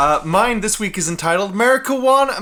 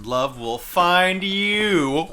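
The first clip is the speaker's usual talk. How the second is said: ordinary talk, nothing marked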